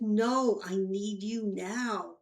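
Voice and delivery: loud, slow, every word pressed; no room for refusal; insistent demand. Retold or imitated. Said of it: "And she said".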